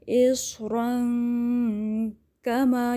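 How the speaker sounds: very slow, kaf kept light like ink; light kaf recitation. Shown as a demonstration. Teacher models the light kaf, chanted slowly. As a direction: chant اس رنگ کما with a thin kaf. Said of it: "جیسے انک،".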